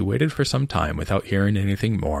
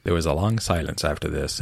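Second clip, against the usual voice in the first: usual speaking voice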